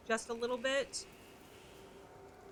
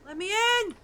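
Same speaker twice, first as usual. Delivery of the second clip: playful plea; 10 semitones higher, 9 dB louder than usual. Pretend. Demanding entry playfully.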